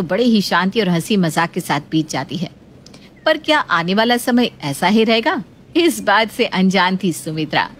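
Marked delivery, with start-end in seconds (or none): none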